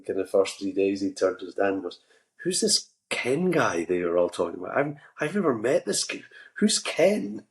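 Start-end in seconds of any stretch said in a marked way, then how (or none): 2.4-7.4 baffled posh English son, pitch climbing high; mock English bewilderment, swooping up; well-to-do English accent. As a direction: imitate a puzzled well-to-do English youngster. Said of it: "some quite well-to-do English actor".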